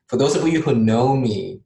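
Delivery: light playful Canadian accent. Shown as a demonstration. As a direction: put on a Canadian accent, jokingly. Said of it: "this is my Canadian accent".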